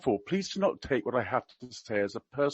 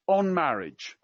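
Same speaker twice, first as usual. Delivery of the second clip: usual speaking voice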